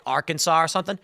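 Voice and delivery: ordinary talk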